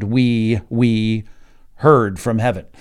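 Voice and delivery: "we, we" drawn out, each one leaned on; emphatic doubled "we". Shown as a demonstration. Teacher reading the Greek word order, emphatic "we". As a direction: set each "we" apart, slow and loud. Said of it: "it gets very emphatic".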